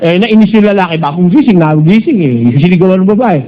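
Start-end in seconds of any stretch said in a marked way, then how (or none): none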